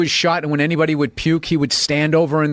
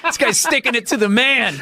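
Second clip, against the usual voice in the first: higher, gleeful onlooker voice; lightly voiced delighted onlooker; amused bystanders; 10 semitones higher than usual. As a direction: cheer him on like a street crowd.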